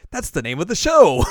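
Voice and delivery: loud, high exclaim; excited shout. Retold or imitated. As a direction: shout it with delight. Contrasting take shouted.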